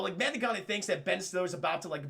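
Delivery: usual speaking voice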